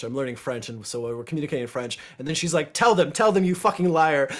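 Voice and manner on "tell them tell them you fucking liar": loud mock angry yell; imitating his partner yelling at him; retold yell. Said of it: "she's yelling at me in French".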